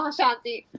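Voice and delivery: normal talking voice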